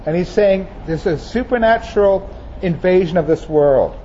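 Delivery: ordinary talk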